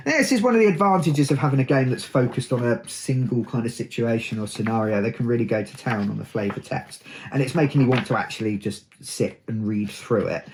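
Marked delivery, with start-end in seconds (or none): none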